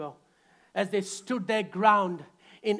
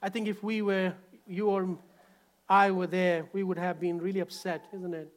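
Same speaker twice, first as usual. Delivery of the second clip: usual speaking voice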